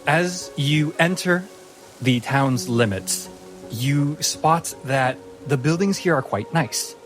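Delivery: normal talking voice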